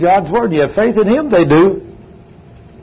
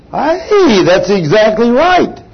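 preacher's shout; 5 semitones higher, 4 dB louder, slower than usual. Real, not acted. Preacher affirming the congregation.

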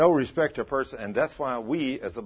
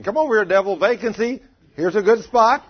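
high, loud mock beckoning; calling out invitation; 6 semitones higher, 7 dB louder than usual. Preacher voicing neon sign luring the devil, calling out. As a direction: call out like an advertising sign.

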